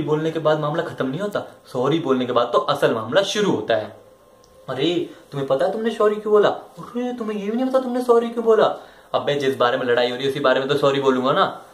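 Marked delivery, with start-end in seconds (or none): 4.7-8.7 high-pitched girlfriend imitation, climbing higher; mock nagging girlfriend. Girlfriend challenging his apology, mock high-pitched indignation. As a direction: mimic an indignant girlfriend, pitch rising as she repeats herself. Mimics girlfriend demanding reason for sorry.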